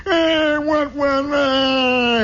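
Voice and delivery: high drawn-out bratty whine; mock little-kid whining; whining kid brother. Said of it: "You know, he's whining".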